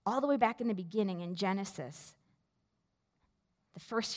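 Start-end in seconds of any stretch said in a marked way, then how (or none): none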